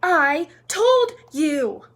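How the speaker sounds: loud, high, drawn-out scolding; gasped reproach. A narrator's reading. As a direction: stretch out an emphatic told-you-so. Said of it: "Hermione gasped".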